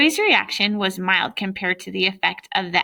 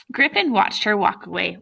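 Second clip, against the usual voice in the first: plain reading voice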